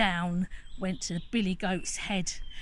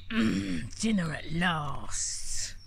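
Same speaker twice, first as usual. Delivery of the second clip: hungry troll; 4 semitones lower, slower than usual. Acted. Troll savouring.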